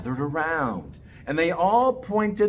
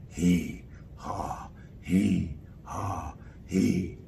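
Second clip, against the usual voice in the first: sawing rhythm; 11 semitones lower, 7 dB quieter, much slower than usual. Acted. Man sawing a log.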